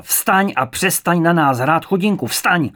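loud, high bellowed order; roaring command. A narrator's reading. Second interrogator ordering a frightened woman up, roaring. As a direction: bellow it right at her. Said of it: "Přiskočil až k ní a zařval".

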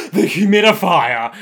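loud gasp and dramatic cry; gasp, then exclaim it in hammed-up alarm; mock horror. Characters spot the humidifier, loud mock horror. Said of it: "they turn around and they're like".

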